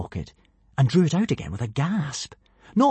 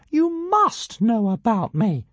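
higher, slow and insistent; piping tiny dragon; 11 semitones higher, slower than usual. A narrator's reading. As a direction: pipe it up indignantly. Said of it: "piped Zigarastika".

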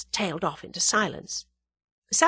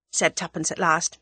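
ordinary delivery

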